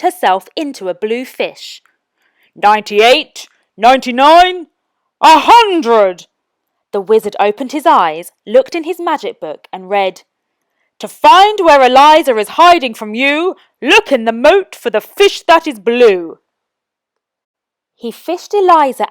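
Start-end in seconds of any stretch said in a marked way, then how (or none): 2.5-6.3 loud, rising count to a hundred; wizard counting. A narrator's reading. Wizard ends his hide-and-seek count, loud. 11.0-16.4 louder sing-song rhyme read aloud; reading a spell. A narrator's reading. Wizard reads his magic book, sing-song rhyme.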